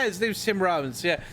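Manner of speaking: usual speaking voice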